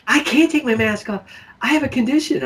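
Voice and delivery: lightly mocking whine; mock woman. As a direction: pitch it up to mock her excuse. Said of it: "the one woman got me".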